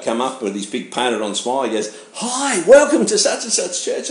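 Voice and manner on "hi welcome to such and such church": high, slow, sugary greeter voice; overzealous greeter. Retold or imitated. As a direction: mimic a grinning church greeter with a fake smile, much higher. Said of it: "the overzealous greeter guy".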